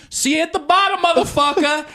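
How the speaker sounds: high, far-off mock friend voice; mock taunting friend. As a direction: yell it back over your shoulder like a cocky show-off. Friend taunting mid-jump.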